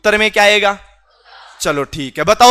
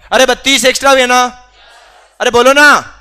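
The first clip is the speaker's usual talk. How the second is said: louder, fast push for an answer; loud urging; 3 semitones higher, 5 dB louder, faster than usual. Real, not acted. Teacher loudly urging a sleepy class to answer. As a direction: call the class out loud to reply. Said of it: "मैं इतना जोर से बोल रहा हूं".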